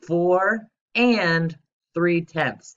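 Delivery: slow and drawn out, every word given its own weight; careful teacher demo; slow word-form read. Shown as a demonstration. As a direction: read it out slowly for the class. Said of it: "let's read it again in word form".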